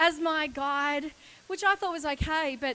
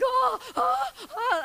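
high, loud excited oh-oh-oh; pumped-up yelps; 9 semitones higher, 6 dB louder, slower than usual. Retold or imitated. Guide imitating her pumped-up yelps, high and loud. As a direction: mimic herself buzzing with nerves before the jump. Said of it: "You're too pumped".

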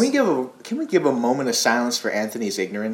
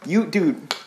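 ordinary talk, nothing marked

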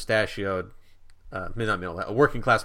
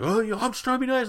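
put-on high, fast slurred drunk voice; drunk man; 13 semitones higher, much faster than usual. Drunk man insists to the kid, high and fast. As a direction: rush it out like a tipsy man pleading. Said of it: "the guy's like".

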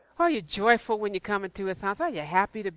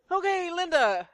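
mock exasperation; 10 semitones higher than usual. Reacting to a friend's endless greetings, amused exasperation. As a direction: humour an over-friendly friend. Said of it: "and I'm thinking".

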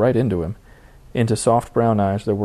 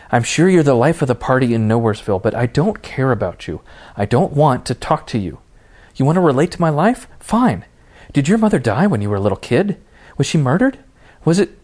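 higher, louder bitter outburst; furious tirade; 4 semitones higher, 3 dB louder than usual. Acted. Raven blasts Jason with her grief and fury. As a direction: unload on him with full malice. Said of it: "blasted away at Jason with both barrels".

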